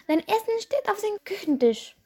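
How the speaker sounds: a hint of a busy mother calling; mother's voice. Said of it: "sagte seine Mutter".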